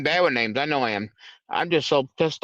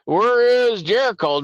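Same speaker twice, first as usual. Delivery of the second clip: louder, slow call for Jericho; 6 semitones higher, 4 dB louder, slower than usual. Real, not acted. Streamer calling out for missing Jericho. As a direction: call out across the room, slow.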